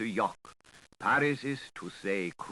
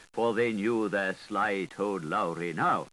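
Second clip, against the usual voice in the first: usual speaking voice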